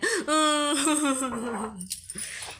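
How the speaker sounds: laughing through real tears; a wet, crying laugh that breaks free; half laugh, half sob; tearful laugh. Real, not acted. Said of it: "रोना आ रहा है".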